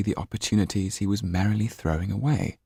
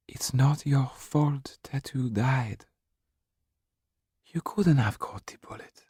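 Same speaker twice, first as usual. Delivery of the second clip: whispered comfort; 8 semitones higher than usual. Acted. Mrs Laxton whispers to Jan it's not his fault. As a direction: comfort him in a hush.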